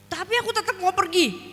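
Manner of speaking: high-pitched defiant insistence; insistent child. Retold or imitated. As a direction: dig in like a stubborn child. Child insisting, acted in story.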